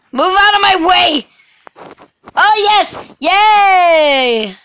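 high, loud cheering; excited shouting. Real, not acted. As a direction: shout in triumph. Wins fight, cheers.

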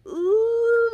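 very high squealed ooh; a sudden high coo of delight; real squeal of pleasure. Real, not acted. Smells the mask again.

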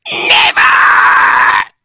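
long, loud screech; shrieking wife; Mrs Twit crying out. A narrator's reading. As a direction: scream one drawn-out word of denial. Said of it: "she cried".

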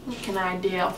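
talking with mouth taped open; mouth propped open. Real, not acted. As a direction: lips held apart, words slurred. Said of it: "I can't close my mouth".

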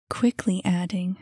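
ordinary delivery, nothing marked

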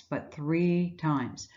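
"three times" slowed right down, each word weighted; slow, heavy emphasis on the number; stress on three times. Shown as a demonstration. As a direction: lean hard on "three times". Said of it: "Not once".

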